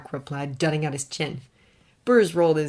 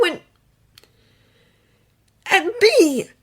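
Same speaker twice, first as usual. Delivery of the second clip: higher choked confession; Clark, choked up; 12 semitones higher, 6 dB louder than usual. A narrator's reading. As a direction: push the words out, close to tears. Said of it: "he choked out".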